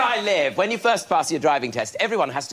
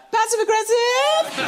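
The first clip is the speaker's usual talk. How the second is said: very high, drawn-out passive-aggressive; squeaky question; slower than usual. Pretend. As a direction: squeak it out in disbelief. Squeaky word for Emma.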